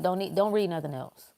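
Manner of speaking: ordinary talk, nothing marked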